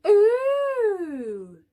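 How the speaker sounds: a high, loud, squeaky chorus of wonder; little bees' ooh. Acted. Little bees marvelling at the new bee, high squeaky ooh. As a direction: coo like a crowd of tiny bees. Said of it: "said the little bees".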